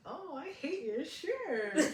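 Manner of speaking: put-on high, drawn-out sugary mean-girl voice; fake nice. Shown as a demonstration. Mean girl showing how tone sounds, fake nice. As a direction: coo the insult sweetly, stretching it out. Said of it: "they say it in a nice way".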